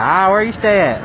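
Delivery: a hint of an old country officer; old man, southern. State trooper questioning the rider, lightly imitated southern old man. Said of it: "talking like an old man".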